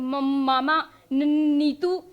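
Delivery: stuck first consonant, then मामा, नीतू; imitated stutter. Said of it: "हमेशा वैसे बोलेंगे".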